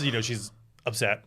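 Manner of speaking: ordinary talk, nothing marked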